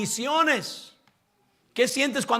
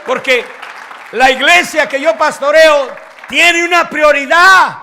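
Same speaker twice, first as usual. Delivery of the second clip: shouted, fervent sermon climax; full-force preaching; 7 semitones higher, 16 dB louder than usual. Acted. Pastor shouting to her congregation, fervent preaching. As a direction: preach it out loud to the whole church.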